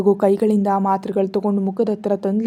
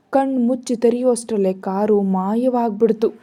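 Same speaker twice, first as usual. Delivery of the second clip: usual speaking voice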